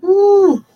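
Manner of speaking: high strained hum from brain freeze; squeezed high mm of pain. Real, not acted. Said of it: "I'm getting a brain freeze".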